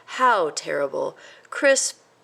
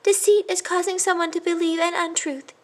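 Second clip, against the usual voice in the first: high, sad little voice; unhappy mouse; 11 semitones higher than usual. A narrator's reading. A sad mouse explains deceit to his cousin. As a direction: explain sadly. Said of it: "said the unhappy little mouse".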